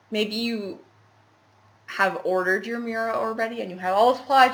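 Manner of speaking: ordinary talk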